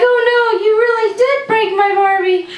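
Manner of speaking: high, whiny little-sister voice. Retold or imitated. Imitating sister upset about her broken Barbie, high whine. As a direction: squeak it like a bratty little sister.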